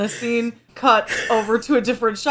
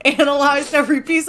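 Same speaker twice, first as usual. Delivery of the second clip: ordinary talk, nothing marked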